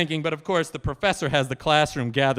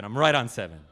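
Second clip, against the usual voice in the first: normal talking voice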